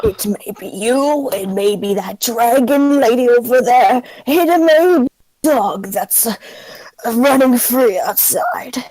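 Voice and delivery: put-on wandering old man musings; lightly voiced old man. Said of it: "old person voice".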